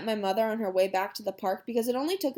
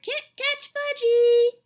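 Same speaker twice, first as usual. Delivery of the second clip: put-on high, loud, drawn-out little-boy taunt; toddler's taunting holler; 7 dB louder, much slower than usual. A narrator's reading. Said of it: "he hollered".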